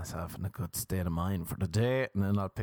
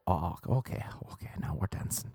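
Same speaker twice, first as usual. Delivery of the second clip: unvoiced mock-intimate whisper. Irish dude finding his way in bed, mock whisper. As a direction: whisper it as pretend dirty talk.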